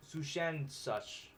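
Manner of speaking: Sushendach drawn out syllable by syllable; careful slow pronunciation. Shown as a demonstration. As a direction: work through Sushendach slowly.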